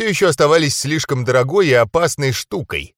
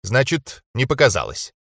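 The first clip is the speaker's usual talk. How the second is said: ordinary delivery, nothing marked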